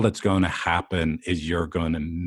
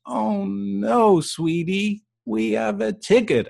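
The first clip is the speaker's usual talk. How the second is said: put-on much higher, cooing baby-talk to a partner; sweet couple voice; 13 semitones higher, slower than usual. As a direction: coo it sweetly like a doting lover. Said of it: "one of you looks at the ticket and goes".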